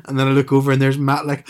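ordinary talk, nothing marked

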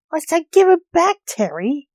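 high, loud, indignant order; insistent demand. A narrator's reading. Armed standoff, repeated demand.

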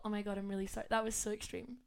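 soft embarrassed sorry; quiet sheepish apology. Retold or imitated. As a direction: hush it, apologetic. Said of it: "you go".

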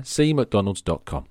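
normal talking voice

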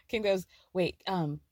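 usual speaking voice

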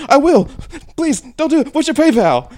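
put-on high pleading girl voice; man doing a woman's voice. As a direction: beg in a high, frantic girl voice.

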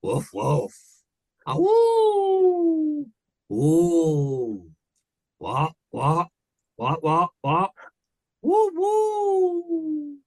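very high, loud woofs and whoops; wild howling pirate crew. Acted. Pirate crew howls as the mate is mauled, high whoops. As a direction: bark and howl like a hyped crowd.

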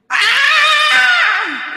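sudden high piercing cat-like shriek; newborn's first cry. Pretend. Preacher mimics a baby's birth cry, high shrill shriek. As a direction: let out a newborn's wail, loud and high. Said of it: "esto sonó como un gato".

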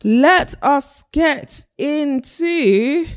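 very high, slow announcer drawl; dramatic announcer voice. Acted. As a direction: announce the topic like a show host.

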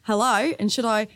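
normal talking voice

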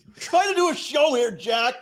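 high, lightly exasperated rebuke; a hint of a fed-up boss; mock scolding. Host scolding Jack as the office Toby, mock exasperated. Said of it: "Jack's our Toby".

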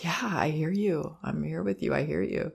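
hushed whisper, close and soothing; soft whisper. Shown as a demonstration. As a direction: whisper reassurance to a crying child. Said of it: "The focus is really".

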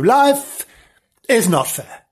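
high LIFE, then a heavy fall on is not fair; a hammed-up solemn verdict to Frank; mock grave pronouncement. Narrator lecturing Frank, hammed-up solemn verdict.

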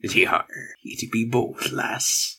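lightly voiced pirate, soft and slow; a hint of an old sea-dog; pirate talk.

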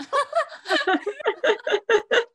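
loud squealing laugh; high burst of laughter. Real, not acted. Laughing at own purchase.

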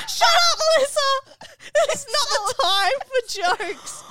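high, loud tearful snap at her friend; snapping at Melissa through tears; upset snap. Retold or imitated. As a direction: cut her off, not in the mood. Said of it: "I'm like".

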